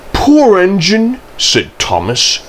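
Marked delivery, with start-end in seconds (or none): 0.1-1.2 sympathetic. A narrator's reading. Thomas hears of Percy's accident.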